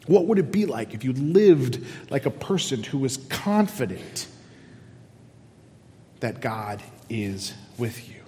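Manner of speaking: normal talking voice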